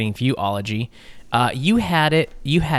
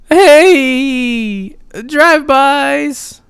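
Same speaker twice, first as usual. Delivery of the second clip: high, loud, very slow Shaggy-like drawl; hammed-up stoner voice stretching the words; old-time gangster; 13 semitones higher, 9 dB louder, much slower than usual. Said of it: "it would probably be like".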